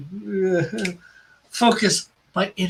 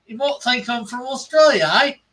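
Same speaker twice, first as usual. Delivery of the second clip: a louder, hammed-up Aussie twang with "aye"; mock Australian accent; 3 semitones higher, 4 dB louder than usual. Teasing friends about where he's from, in a mock Australian accent. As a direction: put on a cheesy Australian accent, a touch higher. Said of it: "always stood and say".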